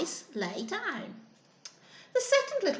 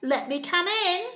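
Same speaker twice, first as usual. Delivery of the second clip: wolf asking; 6 semitones higher than usual. Acted. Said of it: "and said".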